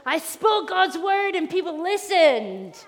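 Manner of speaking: loud yes, slow proud boast; acted elation, drawn out; excited triumph. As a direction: celebrate like a delighted prophet. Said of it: "so excited about this".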